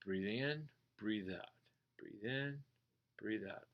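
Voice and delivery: soft, slow guided breath; calm breathing cue. Shown as a demonstration. Meditation teacher guiding the class, soft and calm. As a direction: talk them gently through each breath.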